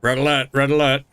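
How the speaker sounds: urgent red alert; starship alarm call. Pretend. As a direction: announce like a ship alarm. Sci-fi ship alarm bit.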